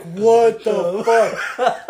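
high, loud, drawn-out disbelief; slow, stunned yelp with laughter around it; mock terrified. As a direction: stretch it out in retold shock. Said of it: "Dude, I was terrified".

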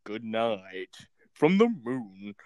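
low, very slow moody goodnight; slow low sign-off. Shown as a demonstration. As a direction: drop low and draw it out like a late-night host. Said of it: "and then this is me".

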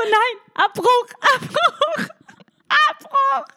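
hoher, lauter, gedehnter Panikruf; panischer Gedanke. Retold or imitated. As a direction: hysterisch den Abbruch fordern, überspielt. Spielt eigene Panik nach. (panisch nachgespielt)

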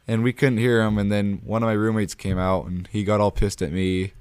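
ordinary delivery

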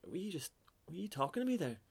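put-on puzzled old man. Old man asking a frog if it spoke, lightly puzzled. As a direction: ask in mock disbelief, voice lifted. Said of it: "and he's like".

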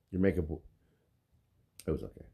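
flat, low, grudging praise; mock unimpressed; deadpan teasing. Real, not acted. Teasing about makeup.

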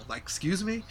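high, incredulous excuse me; mock disbelief. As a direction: play it like you can't believe your own nerve.

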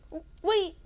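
startled yelp. Acted. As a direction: blurt it out in a panic. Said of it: "Grover yelped".

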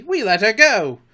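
high, loud, self-satisfied report; complacent. A narrator's reading. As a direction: announce it proudly. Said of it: "Smee answered complacently".

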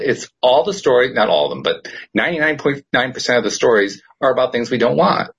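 normal talking voice

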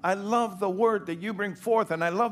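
loud, high, fervent preacher's cry; thundering sermon delivery; full-force preaching. Acted. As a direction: preach it out with fire.

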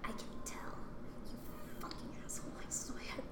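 real whisper, cursing under her breath; whispered aside. Real, not acted. Grumbling to the listener about the rude interviewer, whispered. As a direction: hiss it as if nobody should hear.

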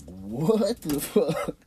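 high, drawn-out, loud bewilderment; stunned disbelief. Retold or imitated. Couple reacting to the protester, stunned. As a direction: stretch it out slowly, high and incredulous.

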